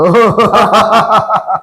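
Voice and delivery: long, rolling ha-ha-ha laugh; hearty laugh. Acted. Husband laughs after his wife sends money, gleeful. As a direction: start on "ho" and laugh it out.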